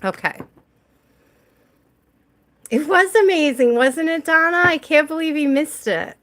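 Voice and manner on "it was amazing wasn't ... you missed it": excited gushing. Real, not acted. Gushing about a missed reading.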